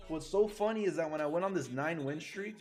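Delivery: usual speaking voice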